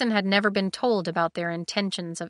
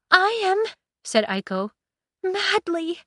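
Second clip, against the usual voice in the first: high swooning declaration; dramatic; 12 semitones higher, 4 dB louder than usual. Acted. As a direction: gush it out, melodramatic. Aiko's dramatic answer.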